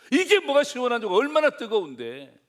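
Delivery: high, loud indignant complaint; child's loud protest. Retold or imitated. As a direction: protest like a scalded kid, stretching the end. Acts out child protesting to father. (아이가 억울한 듯 높고 크게 항의)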